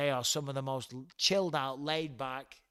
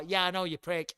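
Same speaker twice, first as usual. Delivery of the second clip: ordinary delivery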